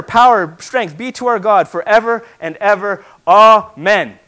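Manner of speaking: loud, climactic sermon delivery; preaching at full force. Acted. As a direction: build to a triumphant roar of praise. Preaching the heavenly worship scene.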